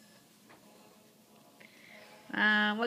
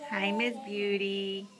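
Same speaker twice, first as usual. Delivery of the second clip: cooing; 3 semitones lower, 5 dB quieter, slower than usual. Real, not acted. Cooing to baby.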